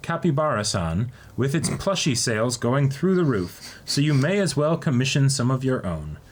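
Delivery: ordinary talk, nothing marked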